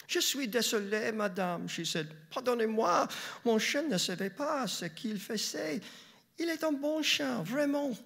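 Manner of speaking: put-on a precocious girl pleading politely in French; young girl speaking French.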